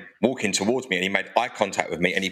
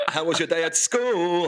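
higher, exaggerated MC voice for a parent's question; put-on garage radio patter; 6 semitones higher than usual. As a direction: pretend this is your everyday garage voice. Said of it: "that's how I usually talk".

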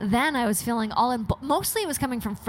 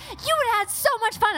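put-on boyfriend's excitement; 12 semitones higher than usual. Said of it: "and he's like".

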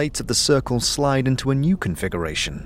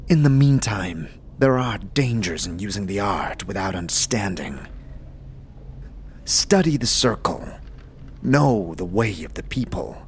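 a low, grave mentor voice; low solemn monk; 4 semitones lower than usual. Acted. GM as Dakon counselling the player, low and grave.

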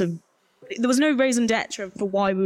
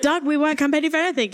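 indignant protest; 8 semitones higher, faster than usual. Real, not acted. Protesting to host.

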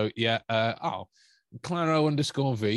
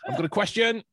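higher, overly sweet mock caller; mock polite caller; 9 semitones higher than usual. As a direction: send up the nice viewer checking in.

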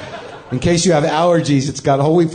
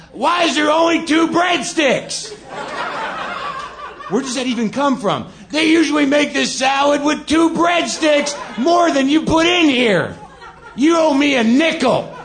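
much higher, loud, laughing mock junkie outrage; angry junkie rant; 11 semitones higher than usual. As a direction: rant like a junkie furious over breadsticks. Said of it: "talking too loud and angrily".

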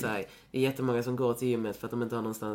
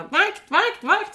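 very high, loud squealing mimicry of the man; imitating a panicked man, pitched way up; shrill-high hysterical protest, mocked; 11 dB louder, much slower than usual. Calling the guard in retold scene.

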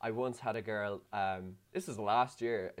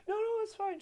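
very high, squeaky placating voice; panicked reassurance. Retold or imitated. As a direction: squeal a comic hurried reassurance. Retold panicked reassurance of crying partner.

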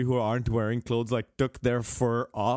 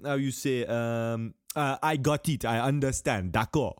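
ordinary talk, nothing marked

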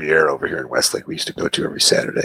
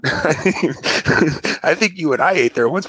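laughing; 8 semitones higher, much slower than usual. Real, not acted. Laughing while recalling buffet.

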